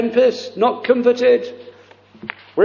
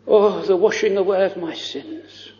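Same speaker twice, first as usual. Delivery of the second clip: ordinary delivery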